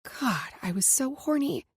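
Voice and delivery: ordinary delivery, nothing marked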